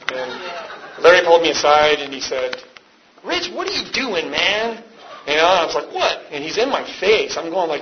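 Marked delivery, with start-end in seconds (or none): 3.2-4.8 high-pitched imitation of a friend remonstrating; imitated confrontation. Larry pulling him aside, mock remonstrating. 5.9-6.3 very high, short yelp; mock indignant surprise. Answering the man in his face, mock indignant.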